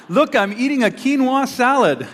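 high, slow, showy boast; mimicking a smug social media show-off; smug poster voice. Mocking a social media post.

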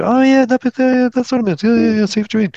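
high, delighted oh-yeah moment; sudden realization. Retold or imitated. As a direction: act out the penny dropping. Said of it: "I'm gonna just go".

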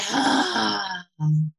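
long slow exhaling sigh; audible sigh. Shown as a demonstration. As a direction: let the breath out in a sigh. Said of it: "let that out with a sigh".

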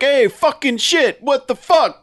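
high, loud, slowed mock cursing; cartoonish outburst. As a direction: yell it like an over-the-top cartoon tough guy. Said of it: "kind of almost this live action cartoonish".

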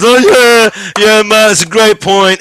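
loud, high, gushing agreement; fawning crowd. Retold or imitated. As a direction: gush like an adoring audience. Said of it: "everyone goes".

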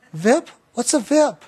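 put-on high, puzzled childlike question; a small boy baffled by a new word; childlike wondering question; puzzled child.

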